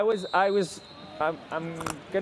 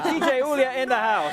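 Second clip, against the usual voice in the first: higher-pitched party announcer call; playful DJ hype; 7 semitones higher than usual. Pretend. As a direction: hype up the DJ like a club MC. Hyping up DJ character.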